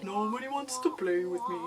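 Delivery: Eeyore. Acted. Inner voice playing the victim, mopey cartoon donkey. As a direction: moan it in glum self-pity. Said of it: "It does that same line".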